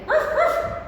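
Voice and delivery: high yappy puppet bark; dog bark. Pretend. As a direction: bark like a small cartoon dog.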